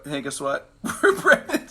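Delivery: squealing high with a laugh, jumping up in pitch; husband imitating a giddy wife announcing pregnancy; mock excited girlfriend. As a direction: play the thrilled girlfriend breaking the big news. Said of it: "the guy gets the girl and girl go".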